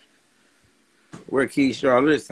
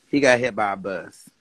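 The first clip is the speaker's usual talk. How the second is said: usual speaking voice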